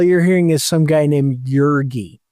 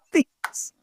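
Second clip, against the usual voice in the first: higher, fast, breaking into laughter; can barely talk for laughing; laughing while talking; 6 semitones higher, much faster than usual. Real, not acted. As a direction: laugh through the words. Cracks up over the names.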